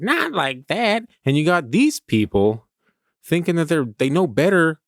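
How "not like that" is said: mock picky customer. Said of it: "They're like".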